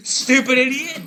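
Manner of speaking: high, loud outburst; shouted insult. Real, not acted. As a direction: yell it at the enemy. Insults an enemy during play.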